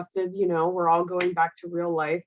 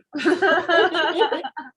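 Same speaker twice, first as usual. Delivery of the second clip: happy laugh; 9 semitones higher, 4 dB louder than usual. Real, not acted. Laughing after cheering colleague.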